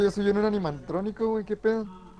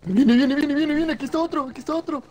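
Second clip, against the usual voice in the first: higher urgent warning; alarmed; 8 semitones higher, 3 dB louder than usual. Real, not acted. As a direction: warn teammate, panicking. Enemy approaching, alarm.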